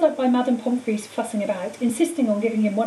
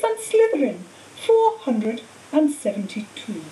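higher-pitched, measured ceremonial address; cheerful old headmaster; 5 semitones higher, 3 dB louder than usual. Acted. Dumbledore announcing house points at the feast, cheerful formality. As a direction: announce grandly, stretching out the scores.

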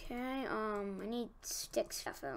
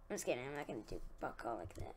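normal talking voice

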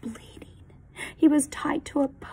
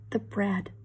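usual speaking voice

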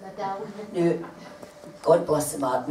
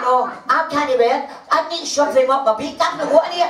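high, louder complaint; indignant protest; 12 semitones higher, 4 dB louder than usual. Retold or imitated. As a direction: act out a friend protesting in exasperation. Acting out friend's indignant complaint.

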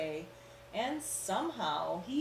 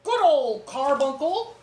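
hearty uncle; 6 semitones higher, 12 dB louder than usual. Acted. Uncle Theodore delighted.

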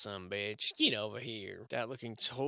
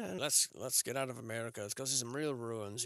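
ordinary delivery

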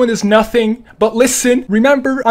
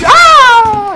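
startled yell; 11 semitones higher, 10 dB louder than usual. Real, not acted. Scream during fight.

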